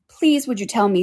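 usual speaking voice

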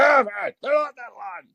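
high-pitched cranky old man yell, hammed up; mock an old man's strained holler; angry old guy. Angry old guy chasing a kid off his lawn, mock yelling. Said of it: "like an angry old guy".

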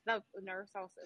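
ordinary delivery

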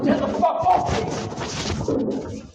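angry yell. Real, not acted. Resisting being held, shouting.

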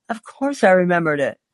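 ordinary delivery, nothing marked

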